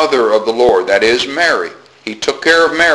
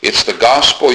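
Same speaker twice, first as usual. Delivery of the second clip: ordinary talk, nothing marked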